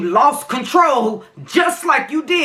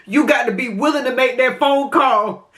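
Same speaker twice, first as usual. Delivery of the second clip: ordinary talk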